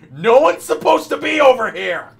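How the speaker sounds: high, loud outburst; loud exclamation. Real, not acted. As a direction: shout it out in disbelief. Exclaiming at useless detail.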